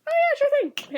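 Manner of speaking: bright mock-innocent yes; cheerful kid. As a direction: toss it off like a carefree kid. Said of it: "He's like".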